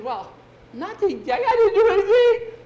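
building to a high shriek; frantic, squealing boy; panicked denial. Acted. Scared boy defends himself.

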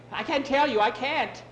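high, loud desperate protest; frightened refusal. Acted. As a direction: refuse in acted panic. Frightened man refuses to tell.

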